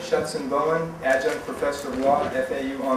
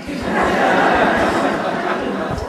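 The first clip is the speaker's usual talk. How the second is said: real laugh; 5 semitones higher, 9 dB louder than usual. Real, not acted. Host laughs at reader remark.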